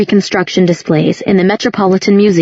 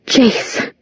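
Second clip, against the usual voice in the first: whispered name, barely voiced; breathless whisper; 5 semitones higher than usual. A narrator's reading. Clary, stunned at seeing Jace, whispering his name.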